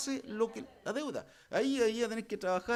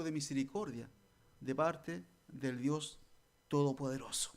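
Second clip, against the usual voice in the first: a gentle, earnest preaching voice, only a little softer; solemn exhortation; 5 semitones lower, 4 dB quieter than usual. Acted. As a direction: draw the congregation in softly. Preacher turns to solemn appeal.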